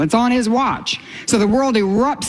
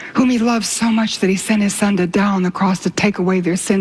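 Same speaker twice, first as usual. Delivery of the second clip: ordinary talk, nothing marked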